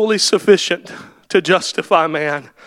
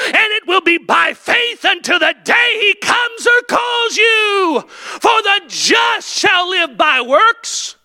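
preaching at full force; 7 semitones higher than usual. Acted. Preacher to his congregation, full-force high shouting. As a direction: hammer home that the cross was wasted.

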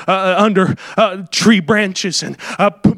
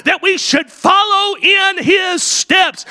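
preached shout; 10 semitones higher, slower than usual. Acted. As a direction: drive the line home from the pulpit. Preached climax of the point.

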